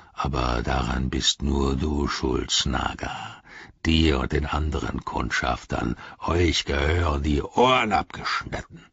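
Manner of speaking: low orc growl; Ugluk growling. Acted. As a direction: growl threats low and menacingly. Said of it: "knurrte Ugluk".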